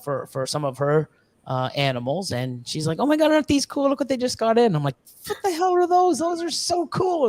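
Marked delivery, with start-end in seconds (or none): 3.0-4.8 high-pitched, thrilled friend's exclamation; imitating an excited friend, pitched way up. Friend excited about new reptiles. 5.3-7.3 very high, wowed exclamation; re-enacted excited amazement; amazed. Retelling amazed reaction to lizards.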